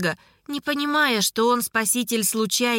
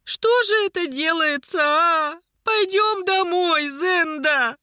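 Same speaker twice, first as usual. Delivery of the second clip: high-pitched, drawn-out wailing plea; lamenting, stretched words, pitched way up; village-granny lament; 8 semitones higher, slower than usual. A narrator's reading. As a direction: wail like an old village woman. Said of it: "как деревенская старушка".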